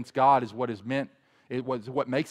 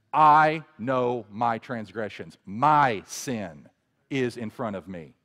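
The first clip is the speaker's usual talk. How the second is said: "I" slow and heavy, loud at the start; emphatic I; 5 dB louder, slower than usual. Shown as a demonstration. Preacher reading David's confession, stressing "I". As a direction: lean on "I know", slow and firm. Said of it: "The "I" in verse three is emphatic".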